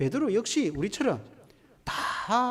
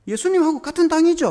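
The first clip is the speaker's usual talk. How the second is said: higher, louder accusing question; pressing accusation; 10 semitones higher, 10 dB louder than usual. Acted. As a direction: corner him with the question.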